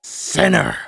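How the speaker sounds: loud accusing shout, high. Acted. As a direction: bellow it like a preacher. Mock accusing shout at Caleb.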